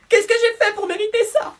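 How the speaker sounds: very high, put-on wronged voice; melodramatic girlfriend. Girlfriend lamenting to her boyfriend, very high mock despair.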